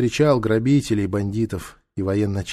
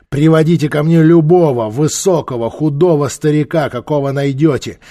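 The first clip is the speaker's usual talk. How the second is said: loud, raised order; commanding; 4 semitones higher, 7 dB louder than usual. Acted. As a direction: give troops a forceful command. Warlord orders soldiers.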